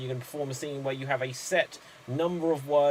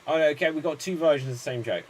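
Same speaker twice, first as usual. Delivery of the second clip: normal talking voice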